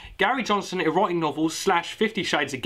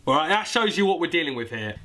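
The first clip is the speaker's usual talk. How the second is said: ordinary delivery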